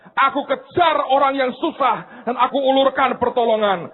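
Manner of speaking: high-pitched, driving sermon delivery; exhorting preacher in full flight; impassioned preaching. Real, not acted.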